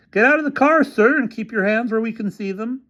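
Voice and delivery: doubt every word he says; skeptical officer. A narrator's reading. Officer doubting the developer's story, high and suspicious. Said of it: "answered one of the officers".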